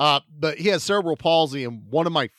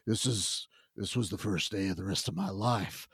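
lower, much softer brooding monotone; mock brooding hero; 8 semitones lower, 8 dB quieter than usual. Imitating the movie hero's backstory, low and soft. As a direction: mumble it like a tortured action hero. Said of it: "he's like".

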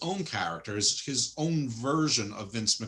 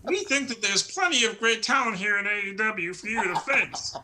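mock cult leader, higher and louder; Evil Uno voice; 9 semitones higher, 4 dB louder than usual. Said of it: "So he's like".

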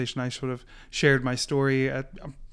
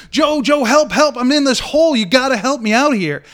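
much higher and louder, frantic calling to a friend; desperate cry for help; 10 semitones higher, 10 dB louder than usual. Retold or imitated. Man in the hole begging his buddy Joe, frantic high shout. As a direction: shout up to your buddy like your life depends on it. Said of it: "he's like".

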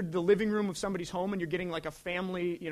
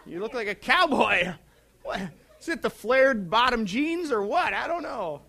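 mock indignant rant; 4 semitones higher, 7 dB louder than usual. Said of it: "It's like".

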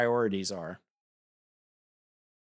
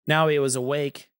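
ordinary delivery

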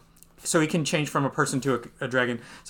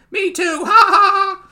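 high, loud acted laugh; gleeful cackle; 8 dB louder than usual. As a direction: crow it high, then cackle loudly. Reading Luffy's line aloud, gleeful.

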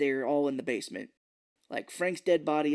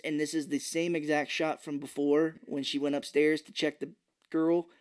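normal talking voice

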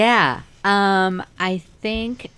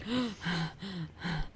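breath sucked in after a near crash; acted shocked gasps; 13 dB quieter than usual. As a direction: gasp like a startled passenger. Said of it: "everybody in the car is like".

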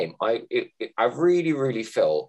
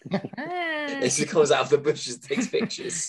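put-on slow, high, breathy old pervert; lightly voiced creepy old man, drawn out; 5 semitones higher, slower than usual. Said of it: "It sounds like the old man from, um, Family Guy".

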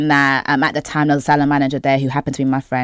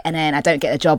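ordinary delivery

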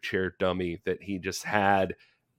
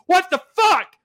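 high, loud outraged disbelief; prisoners yelling; 9 dB louder than usual. Retold or imitated. Prisoners yelling from jail cells at the returning electric chair, shocked yell. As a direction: imitate inmates yelling from their cells, voice shot way up. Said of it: "they're yelling like".

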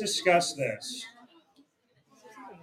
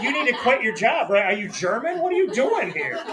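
higher-pitched, mildly indignant questioning; mock incredulous; 6 semitones higher than usual. Telling off the waitress in a retold story, mock incredulous. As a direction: retell it as baffled indignation, pitch climbing.

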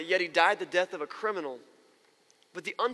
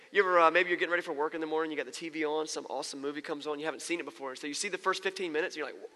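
normal talking voice

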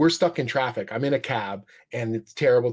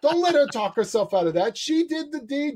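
high, raised mock yell at the cop; trucker yelling; 12 semitones higher than usual. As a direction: keep yelling from the truck window. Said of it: "sticks his head out the window and goes".

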